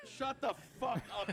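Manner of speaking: high, laughing shout; laughing retort. Real, not acted. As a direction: yell it through a laugh.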